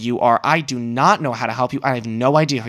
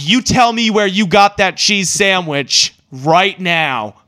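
slow, louder, insistent demand; 5 semitones higher, 4 dB louder, slower than usual. Retold or imitated. Demanding the stranger reveal the sandwich, slow forceful demand. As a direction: lean on each word like an order. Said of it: "I was like".

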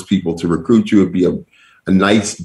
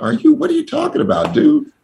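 incredulous reply. Retold or imitated.

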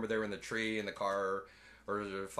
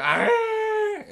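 put-on baby dinosaur screech; 11 dB louder than usual. Baby T-Rex screeches when freed.

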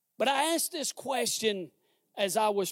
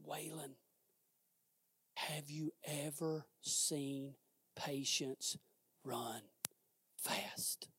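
hushed, low, slow question with pauses; low hushed probing question, sinking away; quiet voice of God; 6 semitones lower, 16 dB quieter, slower than usual. Retold or imitated. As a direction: ask it slowly and very softly, word by word. God puts a question to him.

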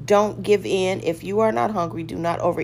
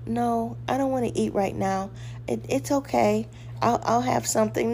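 higher, softer timid voice; meek and unsure, backing down; weak little voice; 4 semitones higher, 3 dB quieter than usual. Shown as a demonstration. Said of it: "don't say it in a little weak voice".

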